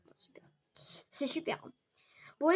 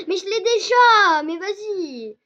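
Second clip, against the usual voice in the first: dismayed shout; 6 semitones higher, 10 dB louder, slower than usual. Real, not acted. Same duplicate gift again.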